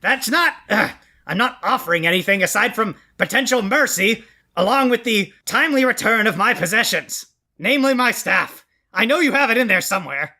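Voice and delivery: high whiny villain pleading; squeaky cartoon villain bargaining; Skeletor voice. Skeletor pleading his case.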